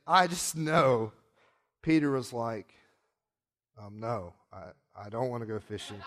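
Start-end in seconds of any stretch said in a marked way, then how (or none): 3.8-6.0 mildly reluctant, low and soft; low, quiet mock reluctance; reluctant refusal. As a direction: act out a hesitant, half-swallowed no. Peter declines Jesus's fishing invitation.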